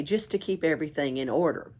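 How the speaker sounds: usual speaking voice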